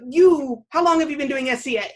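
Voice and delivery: a burst of loud, excited surprise on the first word; excited friend. Retold or imitated. Friend spots her at the event, excited loud greeting. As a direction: call out to an old friend you didn't expect to see. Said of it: "she just ran up to me and was like".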